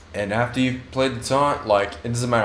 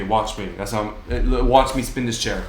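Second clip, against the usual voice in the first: usual speaking voice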